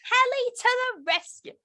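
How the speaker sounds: bright announcer read of the ride lines; helicopter ride voice. Retold or imitated. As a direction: sell it like a kids' ride mascot. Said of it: "So I would say things like".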